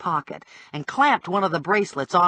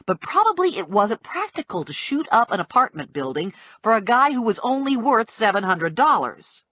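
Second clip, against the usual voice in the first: ordinary delivery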